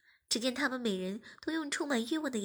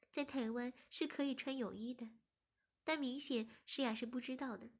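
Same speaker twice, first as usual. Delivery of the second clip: usual speaking voice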